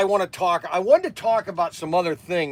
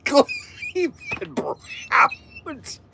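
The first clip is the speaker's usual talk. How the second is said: mock disbelief; 11 semitones higher, 4 dB quieter, much slower than usual. Real, not acted. Mocking the caller's team again.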